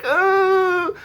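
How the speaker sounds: high, drawn-out mock wail; mock crying. Head knight after her sword breaks, mock crying. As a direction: wail it like a badly faked sob. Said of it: "She starts crying".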